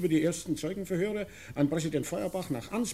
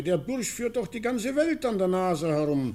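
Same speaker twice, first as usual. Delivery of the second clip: mock-indignant, pitched higher; scornful quote; 8 semitones higher, 3 dB louder than usual. Retelling Wessenich's scornful words with mock indignation. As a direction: sneer out the excuse. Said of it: "sagte er".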